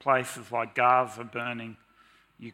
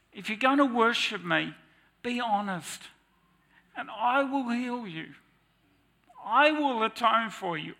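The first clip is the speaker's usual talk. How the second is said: emotional; 10 semitones higher, 5 dB louder than usual. Real, not acted. Preacher overcome while voicing Jesus to the woman, high and emotional. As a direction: voice rising high and loud, overcome. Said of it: "Sorry, I got emotional".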